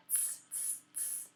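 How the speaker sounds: whispered monkey hiss sounds; soft unvoiced tss tss tss. A narrator's reading.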